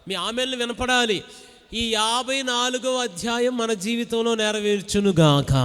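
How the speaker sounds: loud fervent preaching over music; preaching at full force. Acted. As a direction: call the congregation to shout amen. Preacher exhorts amen loudly. (బిగ్గరగా ఉత్సాహంగా ప్రసంగం)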